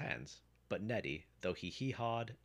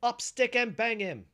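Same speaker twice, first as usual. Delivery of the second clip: very high, loud shouted command; ringing shout to attack; battle cry; 14 dB louder than usual. A narrator's reading. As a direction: cry out the magic order full force. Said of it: "was his cry".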